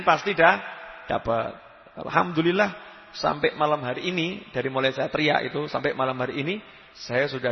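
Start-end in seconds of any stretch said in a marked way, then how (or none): none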